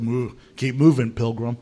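low cowboy urging a wagon on; John Wayne. Retold or imitated. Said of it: "like John Wayne would have said".

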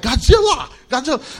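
mock awed shout of Godzilla; gawking locals. Locals staring at the huge American, mock awed. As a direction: gasp it out like a crowd spotting a monster.

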